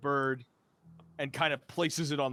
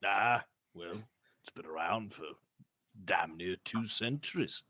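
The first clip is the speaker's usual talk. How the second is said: lower, softer, muttered reply; grumbling noble; 9 semitones lower, 5 dB quieter than usual. Acted. Reginald answers deflated.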